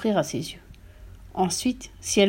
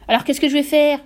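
higher, louder panicky question; désarroi; 6 semitones higher, 6 dB louder than usual. A narrator's reading. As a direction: demand an answer, at a loss. Father despairs over his hair. (question affolée, plus aiguë)

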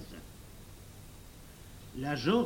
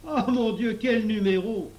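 high, loud outcry; raised-voice boyish exclamation; exclaiming; 8 semitones higher, 9 dB louder than usual. A narrator's reading. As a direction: burst out in amazement. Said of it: "s'écria Gustave".

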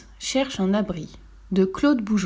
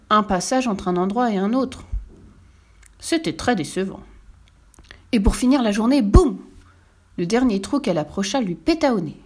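usual speaking voice

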